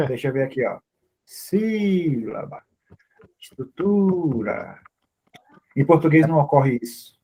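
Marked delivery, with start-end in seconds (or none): 1.2-4.8 very slow, stretched stressed vowel; softly elongated, testing the word; drawn-out words. Shown as a demonstration. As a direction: sound each word out slowly, thinking aloud. Testing example words aloud while thinking.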